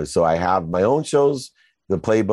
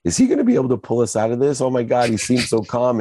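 higher, hurried worried wife; mild retold panic, pitched up and rushed; retold anxious wife; 5 semitones higher, faster than usual. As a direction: fret aloud as the wife, lightly imitated. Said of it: "catching her crying in the house".

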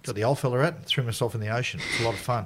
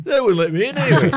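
higher, louder put-on voice of a tipsy mate; sheepish drunk mate; 9 semitones higher, 6 dB louder, faster than usual. Teller voicing his tipsy mate coming home early, in a higher, louder put-on voice. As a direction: play the sheepish drunk friend, pitched up.

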